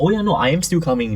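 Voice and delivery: imitating the customer. Founder retelling the flaky customer, mock casual. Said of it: "And he's like".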